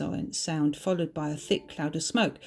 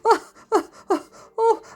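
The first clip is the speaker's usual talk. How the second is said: acted high, loud whooping laugh; hearty laugh; 10 dB louder than usual. A narrator's reading. Morag laughs off the blunt remark, acted hearty laugh. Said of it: "let out a hearty laugh".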